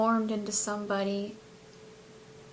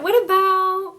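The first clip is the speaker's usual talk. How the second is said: high, loud eager question; excited; 9 semitones higher, 8 dB louder than usual. Retold or imitated. Asking the angel for a new look, excited and loud. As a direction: burst out eagerly, much higher. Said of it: "I was so excited".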